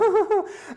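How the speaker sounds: high, pulsing mock boo-hoo; imitated mild crying. Showing what her crying was not, mock sobs. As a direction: fake a child's high sobbing. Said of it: "I'm not talking about".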